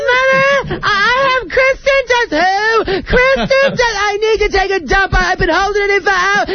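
very high, squealing impersonation; mock red-carpet reporter. Mocking reporters who hand back to Mother, in a shrieky high caricature.

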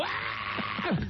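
high, breathless mock yelp of fright; startled yelp. Blind man replaying his own fright on the train, high yelp. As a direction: jump with a high unvoiced gasp-yelp. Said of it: "I jump back into the people behind me and go".

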